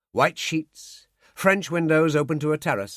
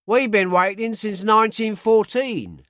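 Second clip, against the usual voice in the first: higher, dry Cockney jibe; Cockney sergeant; 7 semitones higher, 4 dB louder than usual. A narrator's reading. Sergeant ribbing the late American, light Cockney.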